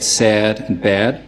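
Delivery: New York "sad" and "bad", vowel gliding like "air"; tense New York "a", toward "eh-uh"; New York accent. Shown as a demonstration. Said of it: "In a New York accent".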